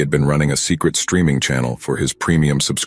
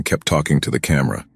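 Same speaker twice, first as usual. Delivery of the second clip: ordinary delivery, nothing marked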